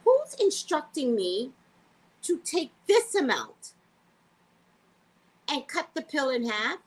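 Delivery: high, outraged questioning; outraged retort. Retold or imitated. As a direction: demand an answer, affronted. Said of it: "And I was like".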